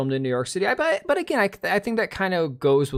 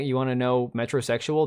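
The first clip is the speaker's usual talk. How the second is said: normal talking voice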